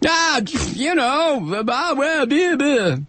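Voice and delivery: high, slow, shrugging blah blah; mock dismissive. Ranter mocking right-wingers shrugging off scandals. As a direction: lightly play someone brushing it off.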